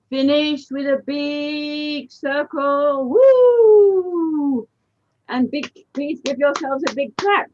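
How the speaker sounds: teacher whooping as the dance ends; excited cheer. Real, not acted. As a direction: cheer the class to the finish. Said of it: "cheering the dancers".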